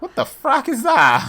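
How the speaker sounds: loud, high, drawn-out disbelief; incredulous outburst. Real, not acted. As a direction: blurt it out, baffled and loud. Startled reaction to a strange phrase.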